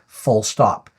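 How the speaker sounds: ordinary talk, nothing marked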